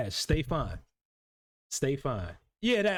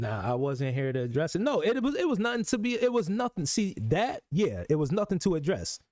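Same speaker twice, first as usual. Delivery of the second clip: ordinary talk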